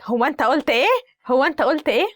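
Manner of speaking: mock-challenging mom, pitch climbing on the repeat; indignant mom. Mom catches child muttering under their breath, indignant challenge. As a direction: play a mother demanding to hear it. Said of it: "the mom is like".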